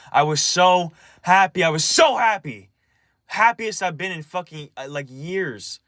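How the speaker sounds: loud, high, anguished emphasis; upset insistence. Real, not acted. Venting about a happy morning ruined, loudly upset. Upset over lost happiness, escalating.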